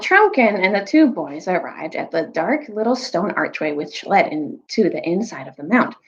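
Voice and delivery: ordinary delivery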